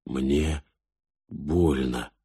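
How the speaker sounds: low, soft, halting admission; pained. A narrator's reading. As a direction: say it quietly and low, as if it hurts. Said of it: "В его странных глазах стояли слёзы". (тихо, с болью)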